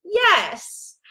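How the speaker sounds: high-pitched yes, falling away; single yes without screaming; spoken yes. Retold or imitated. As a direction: answer on a high, falling note. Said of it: "So I said".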